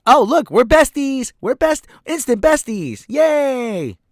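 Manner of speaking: high, loud, gushing fake excitement; mock giddy squeal. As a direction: parody a bubbly girl squealing over a new friend. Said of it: "She says".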